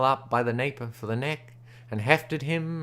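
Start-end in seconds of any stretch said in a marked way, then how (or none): none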